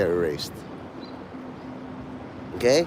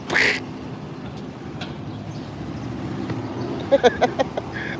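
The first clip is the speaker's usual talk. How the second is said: long wheezing laugh; 10 semitones higher, 8 dB quieter than usual. Real, not acted. Laughs at his own remark.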